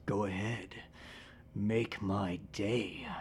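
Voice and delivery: very low, quiet, slow tough-guy impression; Clint Eastwood with some Batman. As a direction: drop it low and soft, stretching the words out. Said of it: "Clint Eastwood with a little bit of Batman".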